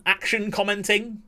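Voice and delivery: ordinary talk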